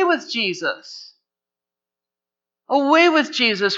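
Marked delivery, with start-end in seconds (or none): 2.7-3.8 higher, louder repeat of the cry; preacher voicing the mob's shout; loud mock crowd cry. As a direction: throw it out like an angry mob.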